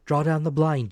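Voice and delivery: ordinary delivery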